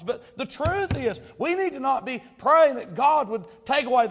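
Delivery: loud, high preaching at full force; impassioned preaching. Real, not acted. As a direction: build to a forceful, high exhortation.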